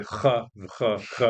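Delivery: throaty "ch" sounds of an Israeli accent; Israeli guttural ch. Shown as a demonstration. Guest showing host his old Israeli accent, guttural "ch". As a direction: rasp out each "ch" in the throat, Israeli-style. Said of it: "spoke Hebrew with an Israeli accent".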